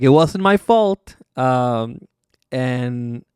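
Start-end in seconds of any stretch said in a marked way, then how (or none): 0.0-1.2 higher, louder, slow defensive plea; hammed-up pleading innocence; mock whiny protest. Del Rio defending himself, mock high and pleading. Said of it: "Del Rio is like".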